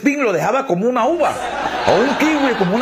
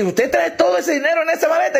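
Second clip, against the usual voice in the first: higher, amazed question; astonished; 8 semitones higher than usual. Acted. Cashier astonished at the suitcase, high. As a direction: gasp it out in disbelief.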